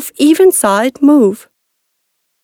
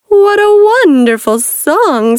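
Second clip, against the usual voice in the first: sly fox voice; 10 semitones higher, 4 dB louder, slower than usual. A narrator's reading. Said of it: "said the fox".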